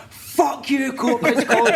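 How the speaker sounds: high, drawn-out mock scream; retold scream. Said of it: "And he'd scream it".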